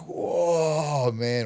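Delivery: high, loud amazed whoa. Retold or imitated. Seeing his grown daughter at a visit, amazed whoa. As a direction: gasp it out in delight.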